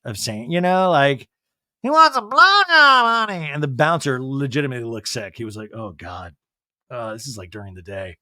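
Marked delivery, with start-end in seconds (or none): 1.8-3.5 high, loud, slow drawl; Erica impression. Erica voiced crudely in recap. 5.7-8.2 low disgusted mutter. Retold or imitated. Disgusted bouncer voiced.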